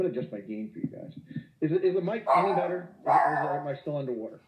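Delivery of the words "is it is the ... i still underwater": louder mic check. Real, not acted.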